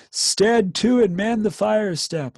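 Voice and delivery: shouted high and loud; officer bawling orders in panic. Acted. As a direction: bawl the order down the trench. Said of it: "gasping and bawling".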